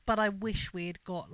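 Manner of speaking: ordinary delivery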